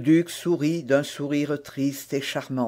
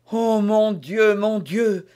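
slow, higher anguished exclamation; anguished cry; 6 semitones higher, 6 dB louder, slower than usual. A narrator's reading. Queen overwhelmed by the duke's words, anguished cry. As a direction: cry out in distress. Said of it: "s'écria Anne d'Autriche".

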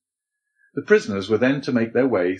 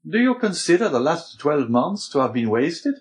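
higher-pitched questioning Abbé; Abbé, reproving; 7 semitones higher than usual. A narrator's reading. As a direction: challenge him with raised pitch. Abbé challenges Dante's complaint.

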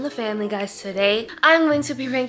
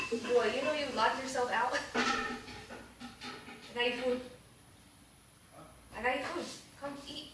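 whispering; 2 semitones higher, 10 dB quieter than usual. Real, not acted. Sneaking around during prank.

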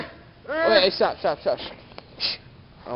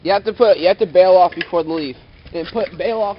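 shouting instructions; 2 semitones higher, 16 dB louder than usual. Real, not acted. Shouting instructions across a skatepark.

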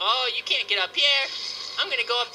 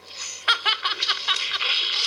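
long chuckle at own pun; pleased, silly cackle; laugh; 7 semitones higher than usual. Real, not acted. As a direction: crack up in rapid short bursts.

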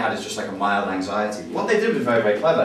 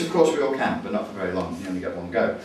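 ordinary talk, nothing marked

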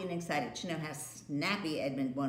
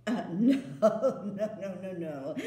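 loud laughing refusal; queen laughing away his begging; 6 dB louder, slower than usual. Acted. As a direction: laugh him off. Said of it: "laughed the queen".

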